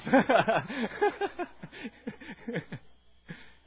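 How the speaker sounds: long, loud, high laughter; loud burst of laughter; hearty laugh. Real, not acted. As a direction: crack up at the reply. Laughs at audience's reply.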